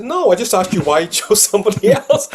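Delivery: laughing protest. Retold or imitated. Defending himself to a client.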